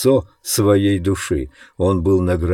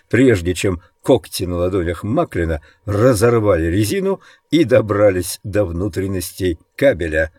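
ordinary delivery

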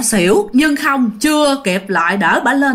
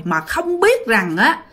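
plain reading voice

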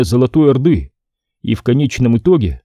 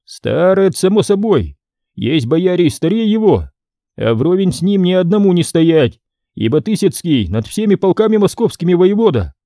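put-on condescending young boyar; 7 semitones higher than usual. Ivan lectures prince.

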